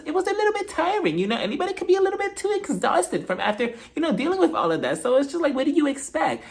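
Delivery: high-pitched, put-on voice; imitated high, hesitant defence; Dorit impression. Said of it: "So Dorit's just like".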